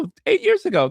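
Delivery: high, emphatic self-correction; correcting herself. Real, not acted. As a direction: snap back up and fix the mistake. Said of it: "no, no".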